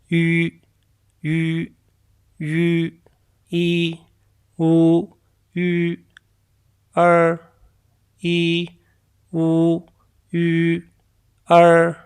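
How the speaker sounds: "यू" with oval lips and the tongue twisted from the centre; Chinese ü sound. Shown as a demonstration. Teacher shapes the mouth to show "यू" to learners. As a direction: round the lips for "यू", then split it into "ई, ऊ". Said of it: "मुंह जो है उसको ओवल shape देनी है".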